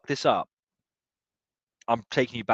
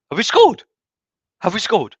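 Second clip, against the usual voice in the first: higher, louder urgent asking; excited disbelief; 11 semitones higher, 12 dB louder than usual. Real, not acted. Fan whose stream froze asks chat, rising excited. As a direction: blurt it out, desperate to know.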